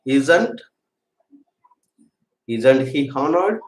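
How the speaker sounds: slow enough for students to write; very slow dictation. Shown as a demonstration. Teacher dictating a question to students, very slowly. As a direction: draw out "isn't he honored?" word by word, rising at the end. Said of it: "teacher dictating question".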